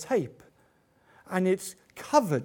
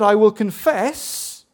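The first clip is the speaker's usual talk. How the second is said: quoted confession; 2 semitones higher, 8 dB louder, slower than usual. Retold or imitated. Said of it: "when I said".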